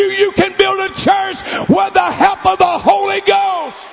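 high, loud preaching shout, rising in fervor; full-force preaching. Acted. Pastor rallying home missionaries, preaching in a loud shout.

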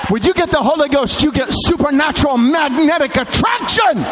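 high, shouted preaching with stretched peaks; full-force preaching. Real, not acted. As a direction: climb into an impassioned pulpit shout.

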